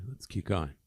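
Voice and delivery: normal talking voice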